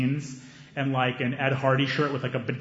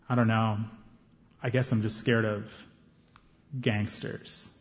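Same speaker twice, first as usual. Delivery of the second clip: low, hesitant admission; subdued, mildly nervous reply; nervous confession; 5 semitones lower, 6 dB quieter than usual. Retold or imitated. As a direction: admit it quietly, with halting pauses. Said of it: "still looking kinda nervous".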